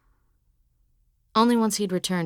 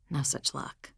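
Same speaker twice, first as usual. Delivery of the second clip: ordinary delivery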